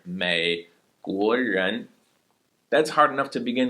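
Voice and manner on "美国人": 美国人 said very slowly, 美 left low; correct low tone. Shown as a demonstration. Teacher models the fix for students, very slow. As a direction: keep 美 down, then 国人 rises. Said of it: "just leave it down there".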